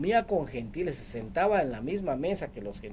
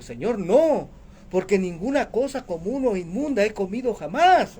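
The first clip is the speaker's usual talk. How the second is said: louder, raised protest of the apostle; emphatic refusal; 4 semitones higher, 6 dB louder than usual. Acted. Apostle answers the Lord's command to eat, emphatic refusal. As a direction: refuse firmly, punching the words. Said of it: "Recordemos que él dijo".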